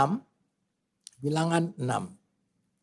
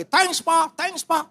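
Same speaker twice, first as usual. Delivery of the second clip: high, eager "Thanks, Pak, thanks, Pak."; excited thanks; 13 semitones higher, 4 dB louder, slower than usual. Retold or imitated. Youngest son leaping up to thank father, excited. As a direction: gush "Thanks, Pak" like a child jumping with joy. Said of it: "langsung loncat dia. Cium saya berkali-kali".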